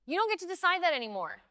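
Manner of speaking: high, fast, forceful pronouncement; father turning on his child; unfamiliar menacing voice. A narrator's reading. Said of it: "in a voice I'd never heard from him".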